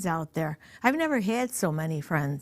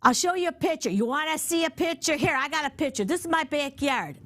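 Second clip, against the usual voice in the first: higher-pitched, eager to prove it; lightly acted insistence, pitched up; mildly insistent retelling; 8 semitones higher than usual. Woman proving to doubters she lives in the country, insistent.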